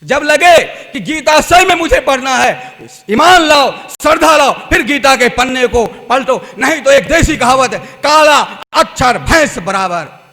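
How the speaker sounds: loud, driving sermon voice; fervent preaching. Acted. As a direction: preach it out to the crowd. Preacher exhorts loudly.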